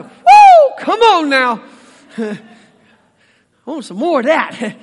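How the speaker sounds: whooping with a laugh; excited whoop. Retold or imitated. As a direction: cheer like the first kiss thrilled you. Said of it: "No, you're like".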